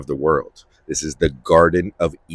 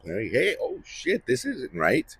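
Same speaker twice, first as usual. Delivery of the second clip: hushed, alarmed realization, breaking into a whisper; startled waking; 5 semitones higher, 7 dB quieter than usual. Acted. Newly aware humans, startled and hushed on waking. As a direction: whisper it like you just woke up somewhere wrong. Adam and Eve suddenly waking up.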